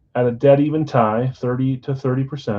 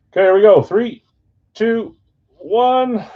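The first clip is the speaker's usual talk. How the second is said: building, higher and louder each number; excited countdown; 10 semitones higher, 7 dB louder than usual. Real, not acted. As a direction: hype up the reveal count. Countdown before opening pack.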